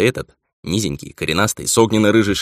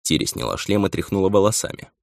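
usual speaking voice

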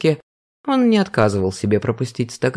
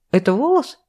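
high rising, stress on волос; incredulous; 9 semitones higher than usual. Acted. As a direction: ask again, leaning on волос. Vera re-asks about hair.

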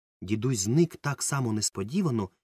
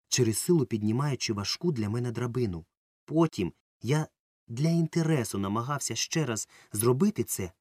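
ordinary delivery

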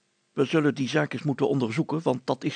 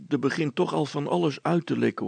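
ordinary delivery, nothing marked

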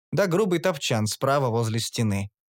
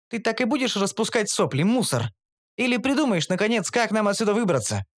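higher, cutting voice, мусор? dropped with a falling tone; lashing reproach; 7 semitones higher than usual. A narrator's reading. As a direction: whip the words at him. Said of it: "произнесла Кара хлёстко". (Кара хлёстко отчитывает героя)

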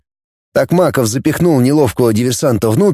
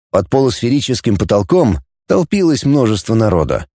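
ordinary delivery, nothing marked

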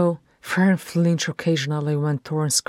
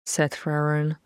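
plain reading voice

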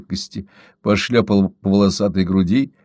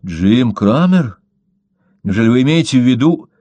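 Garner caught off guard, voice jumps a touch louder; stunned disbelief; 3 semitones higher, 4 dB louder than usual. A narrator's reading. Garner reacting to the news of Cramer, astonished. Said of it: "не мог прийти в себя от изумления".

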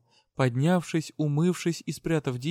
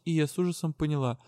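ordinary delivery, nothing marked

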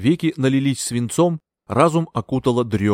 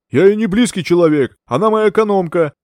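louder, higher angry yell; 7 semitones higher, 7 dB louder than usual. A narrator's reading. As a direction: shout the doctor down. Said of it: "заорал Бертрам". (гневный крик)

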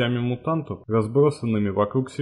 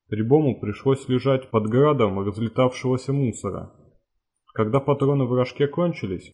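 usual speaking voice

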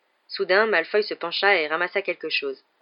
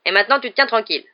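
loud, quick, higher command; brisk, bossy raised voice; forceful order; 4 semitones higher, 8 dB louder, faster than usual. A narrator's reading. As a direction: order him firmly to stay put. Dwarf pins Harry, orders him.

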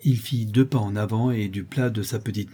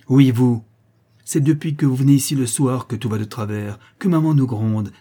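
loud burst on the opening words; angry accusation; 3 semitones higher, 6 dB louder than usual. Acted. Boy blaming Justine for his mother's scolding, angry. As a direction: lash out like a cross little boy.

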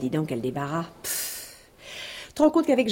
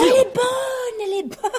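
high, laughing "elle est bonne"; mocking laughter; 11 semitones higher than usual. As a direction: crow over him, laughing. Mocking his doubt about the cheque.

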